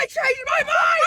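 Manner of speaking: high, drawn-out protesting yell; stretched, high-pitched holler; playful argument shout. Pretend. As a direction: shout it back in mock outrage.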